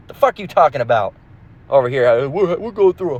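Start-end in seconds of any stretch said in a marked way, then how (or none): none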